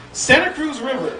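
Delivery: ordinary talk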